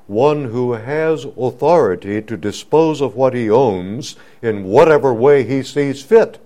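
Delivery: rising to a high, loud cry on "fit"; the preacher drives it home to the congregation, building to a shout; preaching climax. Acted. Climb to a full cry.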